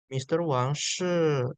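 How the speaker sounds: Mister Wang shi drawn out slowly, each syllable clear; teacher modelling Mister Wang shi slowly; slow model phrase. Shown as a demonstration. Teacher shows the class Mister Wang shi, slowly spoken.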